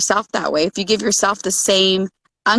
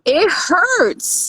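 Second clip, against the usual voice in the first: higher, gentle acknowledging tone; acknowledging parent; 8 semitones higher than usual. Retold or imitated. Parent to child with a skinned knee, higher gentle validation.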